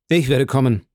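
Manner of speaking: hasty, prompt reply; quick, decided answer; quickly. A narrator's reading. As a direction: blurt it out quickly. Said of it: "sagte Villefort rasch".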